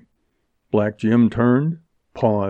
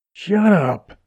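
higher-pitched fierce snap; 9 semitones higher, 3 dB louder than usual. A narrator's reading. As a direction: cut him off sharply. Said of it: "she said fiercely".

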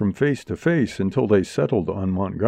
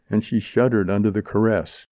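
ordinary delivery, nothing marked

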